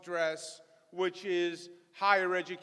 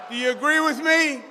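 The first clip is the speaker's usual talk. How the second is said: rallying shout; 5 semitones higher, 12 dB louder than usual. Real, not acted. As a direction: call out loud and higher to the crowd. Rallying the crowd.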